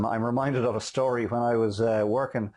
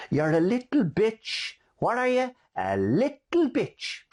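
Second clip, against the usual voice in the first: put-on high, slow sing-song baby talk to a pram; cooing to a baby with a sweet high lilt; coochie coo baby talk; 13 semitones higher, slower than usual. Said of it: "bends down in real coochie coo fashion".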